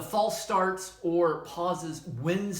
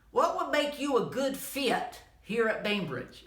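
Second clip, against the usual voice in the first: slow, higher Southern drawl; strong Southern accent; 6 semitones higher, slower than usual. Retold or imitated. Speaker quoting a colleague's stock interview question, strong Southern accent. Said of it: "he does have an accent".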